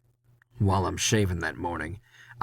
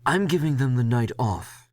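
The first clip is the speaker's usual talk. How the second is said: Leslie, boss; 5 semitones higher than usual. Acted. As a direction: play the boss breezily dismissing it. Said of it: "he says".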